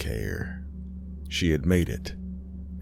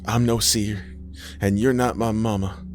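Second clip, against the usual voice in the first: defiant dying woman; 4 semitones higher than usual. Acted. Aisha denies the false mama, defiant. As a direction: stand up to the impostor, weakened.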